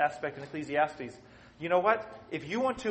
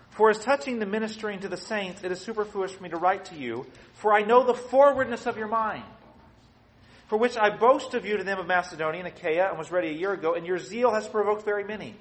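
louder, declaiming the Bible verses; scripture reading; 4 semitones higher, 6 dB louder than usual. Acted. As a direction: read the verse like it's from the pulpit. Reads scripture aloud.

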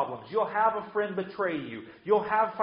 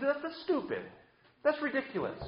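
higher, incredulous scoff; scornful dismissal; 9 semitones higher, 3 dB quieter than usual. Acted. Preacher voicing believers scoffing at TV preachers, high incredulous scoff. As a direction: scoff at it, eyebrows raised. Mocking prosperity preachers, quoted reply.

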